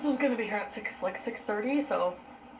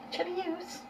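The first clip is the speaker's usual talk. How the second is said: cooing pet voice; 10 semitones higher, 4 dB quieter, slower than usual. Real, not acted.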